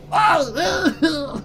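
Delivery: high, loud clown yelp. Pretend. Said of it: "kept the clown voice the whole time".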